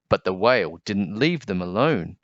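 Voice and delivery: usual speaking voice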